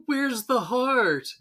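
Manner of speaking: high, drawn-out mock demand; scornful Burns. Burns rubbishing the power plant model, scornful. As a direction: wail it slowly in mock disdain. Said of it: "Burns is just, craps on it".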